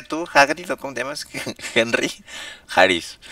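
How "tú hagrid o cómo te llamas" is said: much higher mock squeak; mocking question. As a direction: squeak the name teasingly. Mocking NPC name.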